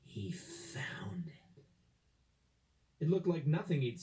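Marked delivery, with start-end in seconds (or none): 0.0-1.3 slow hushed whisper; whispered discovery. A narrator's reading. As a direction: let the discovery land in a breath.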